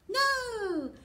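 loud high no!; big surprised no; excited no. Acted. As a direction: shout it out for the reveal. Lift the flap reveal.

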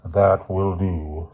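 slow, cold headmaster voice; slow stern headmaster. A narrator's reading. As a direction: cut him off, stern and slow.

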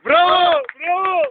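cheering shout. Pretend. Cheering the manager's promise.

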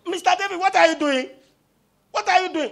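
high, loud mock protest; acted indignant challenge. David's men question their leader, loud high indignation. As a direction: challenge him as an outraged follower would.

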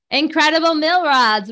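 booming prize announcer. Acted. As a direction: call the winner out proudly. Announcing raffle winners.

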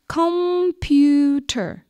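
computer split slowly into three beats; syllable by syllable. Shown as a demonstration. Teacher clapping out a word for pupils, slow syllables. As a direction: say "Computer" as com-pu-ter, slow and separated. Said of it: "One more time".

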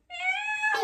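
high squeaky meow; silly meow. Pretend. Reading the book aloud to a child: high silly meow. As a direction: mew it like a tiny cat. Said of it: "he says".

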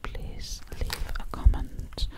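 ordinary talk

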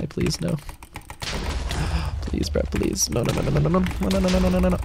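hushed, frantic begging; panicked pleading. Real, not acted. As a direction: plead softly, then rattle off a string of no's. Pleading during danger in game.

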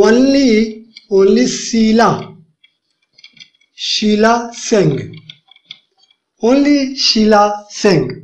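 very slow, word by word; only stretched and stressed; slow dictation. Shown as a demonstration. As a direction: dictate slowly to the class. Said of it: "slow dictation of the translation".

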